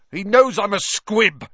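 loud, high-pitched bitter outburst; anguished confession. A narrator's reading. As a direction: force out the shameful admission. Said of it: "he finished".

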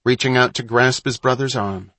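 plain reading voice

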